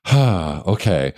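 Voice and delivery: relieved exhale into an okay; sigh of relief. Retold or imitated. Families with an emergency cushion in place, imitated sigh of relief. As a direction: breathe out, imitating someone relieved. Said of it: "there's, like, this sigh of relief".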